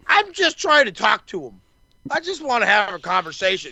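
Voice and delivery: lightly imitated plea, pitched high; high-voiced mock appeal; Kenny. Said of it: "I love how Kenny's so calm".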